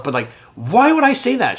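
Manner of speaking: ordinary talk, nothing marked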